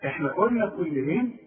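usual speaking voice

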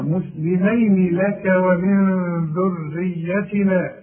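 slow, joined reading with a held nasal n in "ومن"; connected recitation. Shown as a demonstration. Teacher showing the joined reading, connected recitation. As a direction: carry "مسلمين لك" straight into "ومن ذريتنا". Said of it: "إذا وصلنا".

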